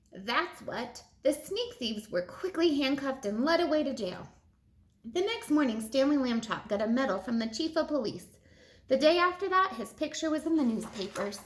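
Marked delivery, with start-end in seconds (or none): none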